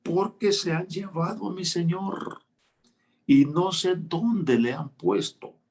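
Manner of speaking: a hint of a weeping woman answering; Mary's grieving reply. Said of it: "vean la respuesta de María".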